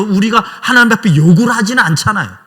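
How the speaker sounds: normal talking voice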